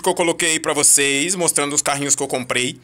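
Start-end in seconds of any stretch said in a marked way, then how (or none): none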